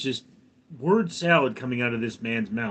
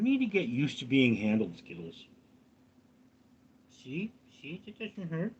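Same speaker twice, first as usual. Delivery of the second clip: soothing the cat; 6 dB quieter than usual. Real, not acted. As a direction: gently reassure the cat while handling her. Soothing the cat being held.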